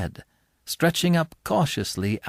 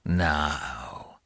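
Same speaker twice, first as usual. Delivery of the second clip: low gruff convict; 10 semitones lower, 7 dB quieter than usual. Acted.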